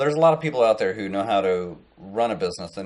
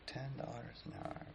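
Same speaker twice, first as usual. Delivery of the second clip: very soft, higher-pitched echo; quiet disbelief; 5 semitones higher, 19 dB quieter than usual. Retold or imitated. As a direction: repeat the offer under your breath, doubting it. He echoes the offer, unimpressed.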